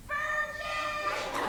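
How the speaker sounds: high, hammed-up yell down the hall; mock yell. Retelling a senior boy yelling at her in the hall, high mock shout. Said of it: "a six-foot-eight senior yelling".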